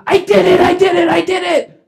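high, frantic shouting; yelled confession. Retold or imitated. Calvin confessing to police in the garage, yelling. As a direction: blurt it repeatedly at a high-pitched yell. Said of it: "with his duffel bag yelling".

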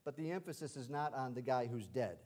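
ordinary delivery

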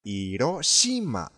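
slow "イロシマ" without H, stressed on シ; Spanish pronunciation. Shown as a demonstration. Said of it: "特になぜかスペイン語で、". (「イロシマ」をHなしのスペイン語風に)

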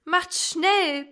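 high, loud urgent plea; a child pleading for rescue, high and loud; urgent wail. Acted. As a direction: cry for them to hurry. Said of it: "Oben jammerten Mutter und Elsie".

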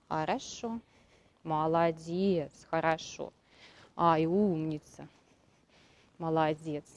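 soft, slow praise to a horse; soothing praise. Real, not acted.

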